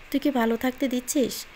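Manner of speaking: ordinary delivery, nothing marked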